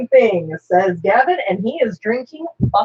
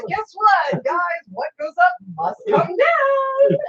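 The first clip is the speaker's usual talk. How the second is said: mock alarm; 8 semitones higher, slower than usual. Warning the guys with a hammed-up yell. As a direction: yell the warning like a panicked know-it-all. Joking about guns fired upward.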